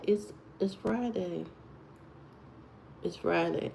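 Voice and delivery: talking low. Real, not acted. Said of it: "I gotta talk low".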